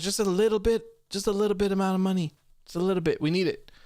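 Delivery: sing-song pleading for just a little bit; playful begging. Real, not acted. Streamer teasing chat about prize money, playful begging.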